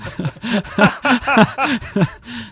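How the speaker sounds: high, long burst of laughter; real high-pitched laugh. Real, not acted. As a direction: laugh out loud at your own joke. Laughing at own deportation joke.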